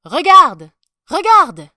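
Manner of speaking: loud, high calling out; excited father. A narrator's reading. Father to his little boy at the procession, excited. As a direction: call your child's attention urgently. Father points out emperor to son.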